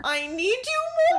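high, rising swoon; swooning echo. Real, not acted. Friend echoing the romantic line, high and swooning. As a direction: sigh it out lovesick and high.

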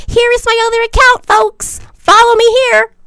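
over-the-top self-promotion; mock announcer. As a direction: hype it like a cheesy promo. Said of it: "I don't post it out and go".

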